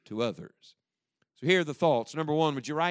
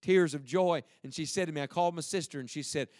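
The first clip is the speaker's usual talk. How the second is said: ordinary delivery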